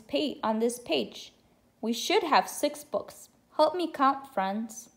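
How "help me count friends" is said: usual speaking voice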